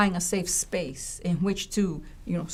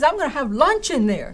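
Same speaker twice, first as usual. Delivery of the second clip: high, louder playful rave; amazed student; 7 semitones higher, 6 dB louder, faster than usual. Retold or imitated. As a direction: gush about the bathroom like a thrilled student. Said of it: "He says".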